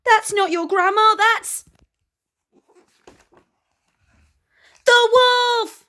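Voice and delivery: high, loud alarmed warning; urgent shout. Acted. Gertie warns the girl, high and loud. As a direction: cry out the danger. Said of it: "Gertie shouted".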